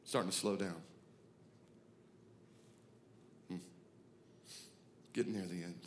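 low, soft, fading voice; winding down. Acted. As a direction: let life ebb away in long pauses. Acting out the end of life.

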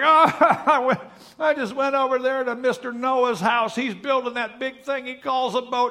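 put-on high-pitched blubbering little boy; mock crying child. Little Billy telling Dad about Noah, mock crying. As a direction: imitate a boy wailing to his dad. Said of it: "little Billy comes home crying".

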